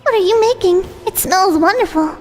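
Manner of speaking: put-on high sweet girlish voice; bright, breathy young girl; high cute anime girl. Voiced female character.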